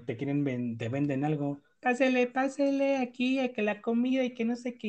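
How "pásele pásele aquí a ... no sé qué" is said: high, loud street vendor cry; singsong market price calls. Retold or imitated. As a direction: call out to passersby like a market seller. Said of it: "Che vocesota. Pero así de, de vendedor". (pregón de vendedor de mercado)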